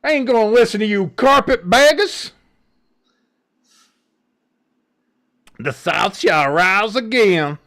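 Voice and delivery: loud, high, slow Southern drawl; hammed-up Southern accent. Host teases Hamster with a loud, slow, hammed-up Southern proclamation. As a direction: proclaim it like a defiant old Confederate. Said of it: "sounds exactly like that".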